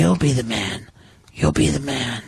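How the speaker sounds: low, soft demon murmur; scary demon voice. Pretend. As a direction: murmur like a sly devil. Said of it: "you're doing the scary demon voice".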